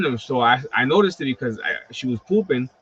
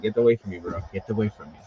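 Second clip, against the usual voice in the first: voicing the dragon; 4 semitones lower, 4 dB quieter, faster than usual. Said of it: "She's like".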